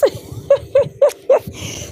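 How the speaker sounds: a high delighted giggle. Real, not acted. Trader laughing at her own math boast, high giggle.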